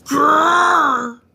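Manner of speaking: long playful growl; lion growl. Acted.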